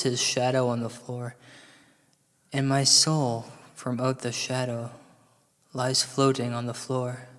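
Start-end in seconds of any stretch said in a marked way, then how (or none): none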